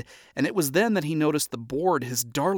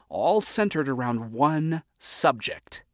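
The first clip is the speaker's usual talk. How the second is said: ordinary delivery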